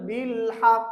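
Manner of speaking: বিল হক with a rebounding final qaf; hold, then pop the end of হক; qalqalah. Shown as a demonstration. Teacher asking learners to notice, bounced ending. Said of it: "খেয়াল করেন".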